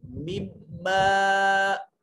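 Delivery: मिम्मा chanted slowly, the doubled म held nasal and the final आ stretched; recited with ghunna. Shown as a demonstration. Teacher models the word for students, slow nasal chanted मिम्मा. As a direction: sing मिम्मा long with a humming म. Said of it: "अलिफ को एक अलिफ के बराबर लंबा करेंगे।".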